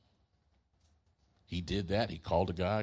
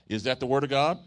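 louder call-out to the church; stronger call; 6 semitones higher, 8 dB louder than usual. Shown as a demonstration. Preacher rousing the crowd, loud call-out. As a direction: demand the answer, loud and higher. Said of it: "No, that was weak".

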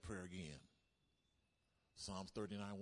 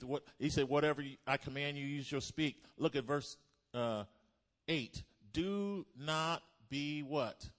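loud, raised-pitch preacher rousing the room; full-force preaching; 7 semitones higher, 9 dB louder than usual. Real, not acted. Preacher rouses congregation in Jeremiah chapter one, preaching at full force. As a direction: drive it at the congregation, high and loud.

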